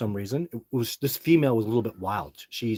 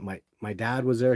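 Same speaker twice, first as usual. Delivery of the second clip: usual speaking voice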